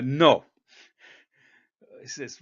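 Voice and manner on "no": blunt refusal. Retold or imitated. Retold curt refusal to requester.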